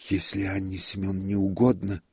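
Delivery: plain reading voice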